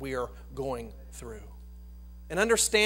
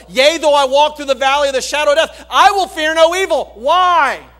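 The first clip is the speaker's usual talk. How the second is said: louder and higher, fiery pulpit preaching; full-force preaching; 7 semitones higher, 11 dB louder than usual. Acted. Preacher rousing the church with David's psalm, full-force preaching. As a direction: preach it out to the whole congregation.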